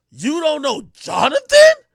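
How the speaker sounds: high, loud, slow mock astonishment; incredulous date. Date shocked he doesn't know the name, incredulous. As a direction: imitate her stunned question, drawn out. Imitating her disbelief.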